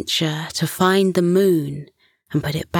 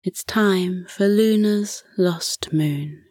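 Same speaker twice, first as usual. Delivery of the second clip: soft, slow bedtime whisper; close, hushed guiding voice; right in your ear; 3 dB quieter than usual. Acted. Bedtime breathing guide, soft.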